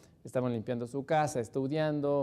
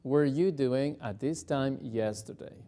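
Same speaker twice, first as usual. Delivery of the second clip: deliberate and clear; slow practice question; slow drill; slower than usual. Shown as a demonstration. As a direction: model it slowly. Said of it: "repetir muy despacito".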